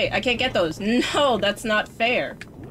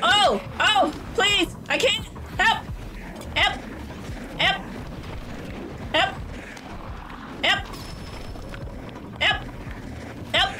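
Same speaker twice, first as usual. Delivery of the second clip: high panicked plea; 11 semitones higher than usual. Real, not acted. Gamer overwhelmed by enemies, high panicked pleading.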